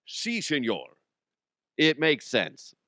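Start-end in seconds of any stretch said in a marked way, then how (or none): 0.1-0.8 put-on lightly Scottish-accented Spanish; a touch of Scottish movie star; Sean Connery. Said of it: "how I learned how to do Connery".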